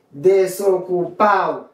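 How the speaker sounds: Desocupado said "de-so-cu-PAO", falling; "Desocupado" with the last D silent; dropped D. Shown as a demonstration. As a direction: glide A into O at the end of Desocupado. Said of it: "ou qu'à prononcer ou qu'à pas".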